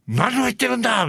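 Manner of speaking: high-pitched mock angry yell; red-faced outburst. Producer explodes at his art staff, mock furious. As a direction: blow up like an outraged boss, hammed-up. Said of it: "ミスター・アレンが真っ赤になって".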